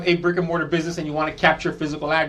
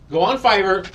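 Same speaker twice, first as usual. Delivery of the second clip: normal talking voice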